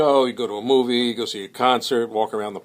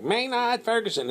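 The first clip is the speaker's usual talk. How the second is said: imitating an MC in a high, squeaky voice; high-pitched MC voice; 11 semitones higher than usual. Pee Wee Marquette announces Maynard in a high-pitched voice. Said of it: "he has a real high-pitched voice like".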